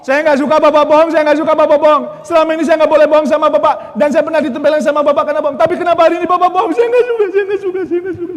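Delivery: loud, high, whining cry at father; childish angry protest. Retold or imitated. Boy on the bike yelling at his father for lying. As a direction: yell it like a hurt child pounding his back.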